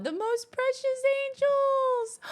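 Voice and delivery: very high, loud, slow squeal; soaring squeal, then a gasp; adoring squeal. Real, not acted. Gushing over kid pictures.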